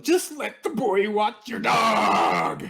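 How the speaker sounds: high, loud, slow mock yelling. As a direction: yell it like an exasperated uncle. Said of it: "where you start yelling".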